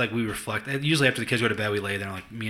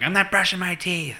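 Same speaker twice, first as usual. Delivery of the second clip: mimicking daughter; 8 semitones higher than usual. Child defiance imitated.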